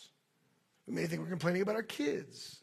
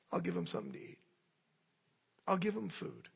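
gentle; 5 dB quieter, faster than usual. Retold or imitated. God answering his complaining people, gentle and soft. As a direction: calmly reassure the grumblers. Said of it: "No, he says".

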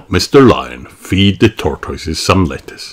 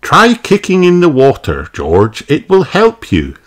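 high, lilting mother's voice; Mummy Pig; 9 semitones higher than usual. A narrator's reading. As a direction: encourage a little one in a high voice. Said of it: "says Mummy Pig".

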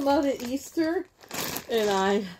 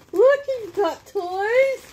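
pet talk; 8 semitones higher than usual. Real, not acted. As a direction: coo at the dog. Talking to her dog Zen.

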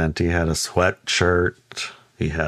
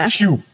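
high, quick whoosh word; zipping-off noise; 10 semitones higher, 3 dB louder than usual. Retold or imitated. Teller showing the fairy zipped off, imitated whoosh. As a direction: imitate a fairy darting away. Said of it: "And it zipped off".

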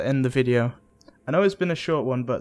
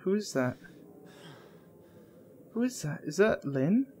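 high, soft scared question; scared whisper; 5 semitones higher, 7 dB quieter than usual. Real, not acted. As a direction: ask nervously under breath. Unknown figure seen.

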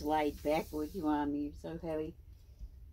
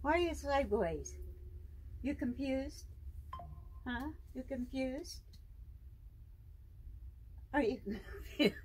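high, sing-song questions to the pet; 7 semitones higher, 4 dB quieter than usual. Real, not acted. Owner teasing her python about being sideways, in high baby talk.

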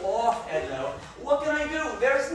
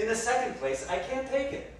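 ordinary talk

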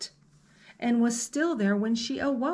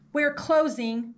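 1 guide calling; 5 semitones higher, 4 dB louder than usual. Acted. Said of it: "said the guide".

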